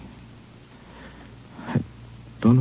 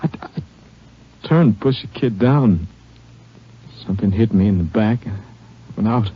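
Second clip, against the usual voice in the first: grieving, halting; 5 semitones higher than usual. Acted. Wounded father telling the Ranger of his boy, grief-stricken and halting. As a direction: falter through it, struggling to go on.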